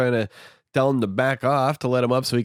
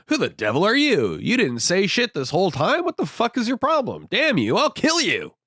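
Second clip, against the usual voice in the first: mock angry outburst; 10 semitones higher than usual. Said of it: "So he's like".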